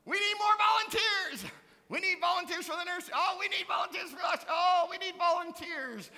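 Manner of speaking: high, loud mock-desperate appeal for volunteers; pleading shout. Pastor mimicking his own weekly plea for volunteers, loud and high. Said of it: "used the word volunteer".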